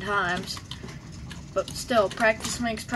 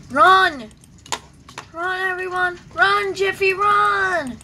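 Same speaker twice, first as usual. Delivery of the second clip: mock panic shout; 8 semitones higher, 8 dB louder, slower than usual. Urging everyone to flee.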